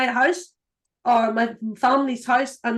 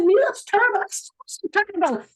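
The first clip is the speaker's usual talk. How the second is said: mock pity; 9 semitones higher than usual. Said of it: "Where somebody said".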